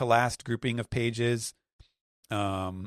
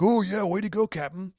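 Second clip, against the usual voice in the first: high, eager cheering; cheering henchmen; 11 semitones higher than usual. Retold or imitated. Henchmen praising their captain, high eager cheer. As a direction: cheer on the boss. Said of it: "they're like".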